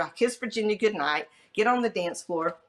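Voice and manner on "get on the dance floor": ordinary delivery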